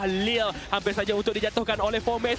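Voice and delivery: fast, lively play-by-play call; esports caster. Acted. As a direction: call the action live. Live play-by-play casting.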